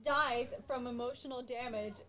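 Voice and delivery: ordinary talk, nothing marked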